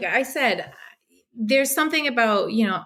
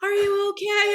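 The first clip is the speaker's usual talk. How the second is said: worried dad; 10 semitones higher than usual. Retold or imitated. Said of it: "He's like".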